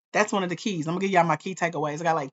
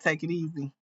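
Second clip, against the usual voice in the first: usual speaking voice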